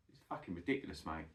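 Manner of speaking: ordinary delivery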